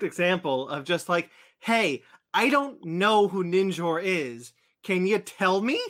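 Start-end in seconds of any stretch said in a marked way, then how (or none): 1.6-5.8 slow confused voice. Acted. Voicing a confused reader.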